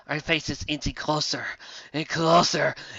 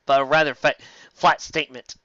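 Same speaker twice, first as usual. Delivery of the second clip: ordinary talk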